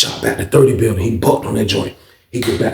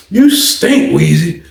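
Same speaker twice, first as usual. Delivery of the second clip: high teasing voice; 4 semitones higher, 4 dB louder, much slower than usual. Retold or imitated. Popcorn teasing him about smell.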